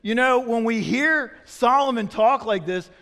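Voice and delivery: ordinary delivery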